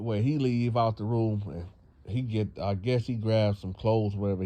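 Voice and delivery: ordinary talk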